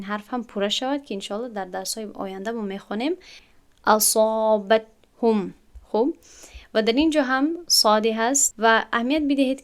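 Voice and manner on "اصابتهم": very slow اصابتهم with a full heavy ص; drawn out اصابتهم, tongue back raised; slow emphatic recitation; heavy sad. Shown as a demonstration. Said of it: "کوشش کنید که پشت زبان خود را".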